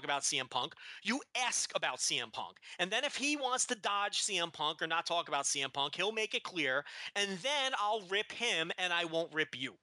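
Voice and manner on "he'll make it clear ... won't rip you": ordinary talk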